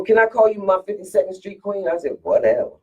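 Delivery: normal talking voice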